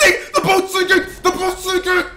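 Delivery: high, loud alarmed cry; panicked shout at the sinking boat; real panic. Real, not acted. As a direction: yelp as the boat goes down. Boat sinking under him.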